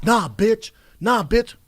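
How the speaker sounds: loud, high mock yell through the wall; neighbor shouting. Comedian imitates a neighbor yelling through motel walls, loud mock yell. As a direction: belt it like a guy yelling next door. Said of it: "I wake up to next door shouting".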